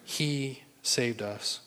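"He" drawn out slowly, then a pause before "saved us"; slow, measured weight on "He"; stress on He. Real, not acted. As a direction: hold the word "He", then let "saved us" follow. Contrast with saving ourselves.

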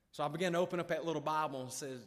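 ordinary delivery

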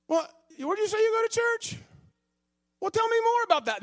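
very high, keen asking voice; a hint of an eager stranger; eager questioner. Acting out a chat with a stranger.

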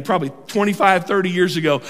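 ordinary talk

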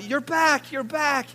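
high, loud joyful cry; excited greeting. Retold or imitated. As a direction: imitate a wife calling out in delight. Wife runs out to greet husband.

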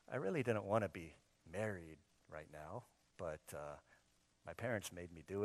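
mock complaining prayer. Imitated whiny prayer.

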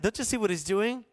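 normal talking voice

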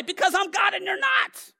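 shouted high and loud, final; God's blunt retort. Retold or imitated. Pastor voicing God to the people, a shouted blunt retort. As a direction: slam it down as God. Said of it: "That's my translation".

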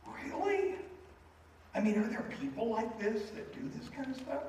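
high, incredulous waitress voice; amazed disbelief. Retold or imitated. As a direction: sound stunned that people do this. Waitress amazed by strangers' kindness.

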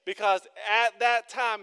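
loud, high preacher's exhortation; fervent preaching. Acted. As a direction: drive the plea home to the crowd. Preaching at full force.

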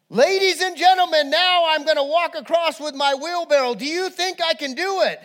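high, loud showman addressing a crowd; booming ringmaster-style announcement; great showman. Acted. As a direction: call out to thousands like a tightrope daredevil. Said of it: "He was a great showman".

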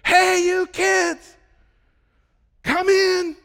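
loud, high, drawn-out shout; a parent bellowing from the house; yelling out the window. Retold or imitated. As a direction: holler the kids in from the window. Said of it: "Stop sticking your head out the window".

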